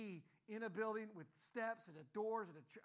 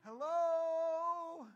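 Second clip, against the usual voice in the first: high, loud, drawn-out scared call; scared shout; 8 semitones higher, 15 dB louder than usual. Retold or imitated. Backing away from footsteps, calling out loud and scared. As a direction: yell hello, spooked and backing away. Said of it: "I start walking back a little bit".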